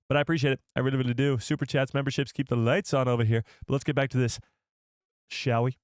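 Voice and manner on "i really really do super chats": usual speaking voice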